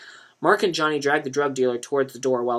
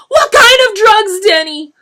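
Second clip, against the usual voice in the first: much higher, much louder sobbing cry; sobbed; 17 dB louder, slower than usual. A narrator's reading. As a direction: sob the question at him. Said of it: "Lisa sobbed".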